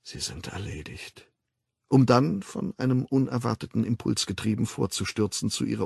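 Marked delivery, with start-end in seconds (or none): 0.0-1.3 breathy whisper, much softer; a hushed aside under the noise of battle; murmured. Acted. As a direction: mutter it to yourself in dread. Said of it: "murmelte Bernhard".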